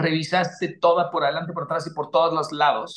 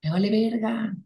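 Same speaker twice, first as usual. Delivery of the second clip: lazy ego voice. Retold or imitated. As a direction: mumble like a lazy ego making excuses. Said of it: "Mi ego dice".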